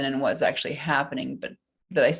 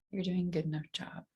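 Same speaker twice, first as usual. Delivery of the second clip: soft affirmation; 8 dB quieter than usual. Acted. Repeating tapping affirmation softly.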